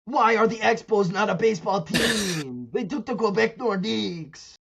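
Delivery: high, put-on whining lament; hammed-up crybaby protest; mock crying fans. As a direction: imitate sore Montreal fans bemoaning lost teams. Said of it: "they're still crying about the Expos".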